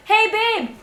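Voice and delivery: lightly voiced husband calling out; imitating Malin. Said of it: "or he will be like".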